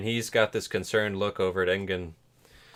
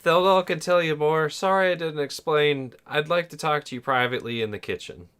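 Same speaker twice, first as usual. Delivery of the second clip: higher, louder mocking imitation of a friend; 7 semitones higher, 5 dB louder than usual. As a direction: parrot his apology in a silly voice. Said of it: "He basically said".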